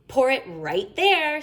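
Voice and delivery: put-on high, slow, bossy little girl; little sister's voice. A narrator's reading. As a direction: direct the gravy like a tiny chef. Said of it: "she said".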